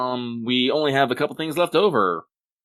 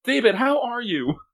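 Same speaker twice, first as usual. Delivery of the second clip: very high squeal of comic disbelief; hammed-up high-pitched fretting; mock incredulous concern; 13 semitones higher than usual. Mock alarm at cut-out boots.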